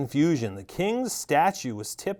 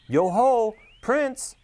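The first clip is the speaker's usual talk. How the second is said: high, loud hail into the forest; calling out; 10 semitones higher, 4 dB louder, much slower than usual. Acted. Governor calling to the hidden voice in the forest: high loud hail. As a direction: call to someone unseen. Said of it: "the governor called out".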